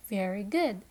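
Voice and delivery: normal talking voice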